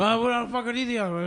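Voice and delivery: flustered, rising voice; heated. Retold or imitated. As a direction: caught off guard and heated. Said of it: "you got heated quick".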